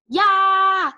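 high, loud battle cry; shouted. A narrator's reading. Clay lunges with his fake claw, shouted battle cry. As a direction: lunge with a drawn-out yell. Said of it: "Clay shouted".

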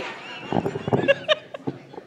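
high breathy burst of laughter. Real, not acted. Presenter cracking up at her own candy joke, high laugh. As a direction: break up completely.

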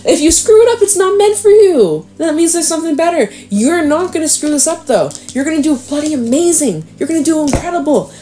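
high-pitched, emphatic encouragement; excited reassurance. Real, not acted. Tarot reader reassuring a worried Aries, excited.

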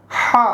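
হা from the middle of the throat; throat sound. Shown as a demonstration. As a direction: push the h of হা out from mid-throat. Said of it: "হলকের মাঝখান থেকে". (গলার মাঝখান থেকে হা)

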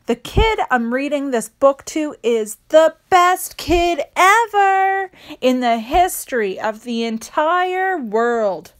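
plain reading voice

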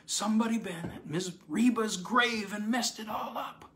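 high, moaning plea. Acted. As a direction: moan it out, close to tears.

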